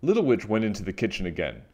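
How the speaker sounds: plain reading voice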